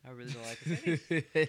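loud, laughing through the words. Real, not acted. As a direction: say it while cracking up. Laughing remark about photo.

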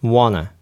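"want to" run together into "wanna"; T swallowed. Shown as a demonstration. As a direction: merge into casual "wanna". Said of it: "パターンの三つ目". (Tを飲み込んだ「wanna」)

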